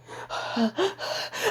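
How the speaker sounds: sharp, airy acted gasping; shocked gasps. Little girl seeing her hurt papa, shocked gasps. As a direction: gasp in mock horror.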